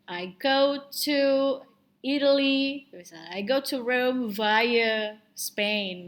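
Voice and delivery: slow, spaced-out words with "via" as vai-uh; example sentence modelled. Shown as a demonstration. Teacher gives an example sentence, slow, "via" as vai-uh. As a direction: spell it out slowly, "via" as VY-uh. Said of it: "the way to pronounce it is via".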